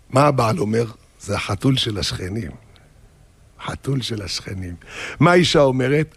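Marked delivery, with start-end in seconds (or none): 1.2-4.8 low, soft, sleepy husband; sleepy dismissal. Acted. Husband in bed blaming the cat, sleepy and low. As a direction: mumble it low as if half asleep.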